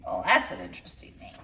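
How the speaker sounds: quick conspiratorial whisper; whispered aside. Real, not acted. Reader whispering a side remark to children, hushed. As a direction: lean in and whisper it fast.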